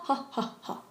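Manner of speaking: ha breathed out with airy h; breathy Korean ha; breathy h demo. Shown as a demonstration. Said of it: "So ha".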